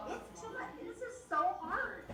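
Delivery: high, mocking moan of the other moms; whiny mock complaint. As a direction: mimic a complaining mom, whining. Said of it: "But I hear them arguing".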